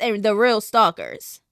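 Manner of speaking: normal talking voice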